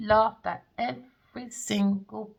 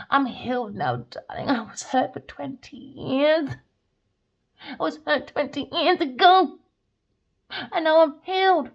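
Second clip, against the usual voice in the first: higher, louder mocking of her healed story; mocking her claim of being healed, higher and louder; mock smug claim; 7 semitones higher, 6 dB louder than usual. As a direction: parrot her sneeringly.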